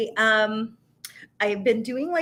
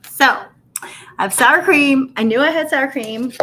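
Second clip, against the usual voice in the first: excited; 4 semitones higher, 7 dB louder than usual. Real, not acted. Excited discovery of sour cream.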